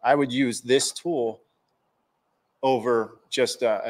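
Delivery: ordinary talk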